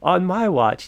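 drawn-out playful father's proclamation; mock unaccepting father. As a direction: imitate dad hamming up his disapproval. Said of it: "acting like this, um, unaccepting father".

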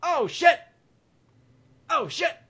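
high, loud alarmed outburst; shocked. Acted. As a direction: shout it in sudden panic. Shocked reading the date.

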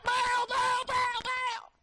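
rapid-fire high mock wailing; exaggerated high chant of desperate worshippers; crying out. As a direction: call the name over and over, hammed-up. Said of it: "And they're crying out".

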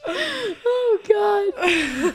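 laughing. Real, not acted. Collapsing into laughter.

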